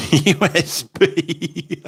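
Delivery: high, drawn-out laughing delivery; laughing punchline. Real, not acted. Host reading out a viewer's bee joke, laughing hard. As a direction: crack up while saying it.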